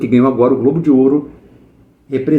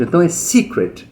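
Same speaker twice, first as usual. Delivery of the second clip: "secret" with the first syllable "se" pulled up and stressed; 2 semitones higher than usual. Shown as a demonstration. Teacher showing students the stressed syllable of "secret", first syllable pushed up. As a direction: lift the start of "secret". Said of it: "Puxa pra cima no começo".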